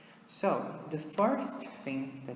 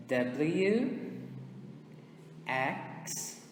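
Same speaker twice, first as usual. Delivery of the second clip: isolated letters with long pauses; repeat-after-me drill; 3 dB quieter, much slower than usual. Shown as a demonstration. Teacher leading students through the alphabet, spaced repetition drill. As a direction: say each English letter alone, then wait. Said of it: "Repeat with me".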